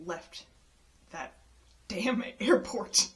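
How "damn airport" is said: laughing curse. Real, not acted. Laughing relief at leaving.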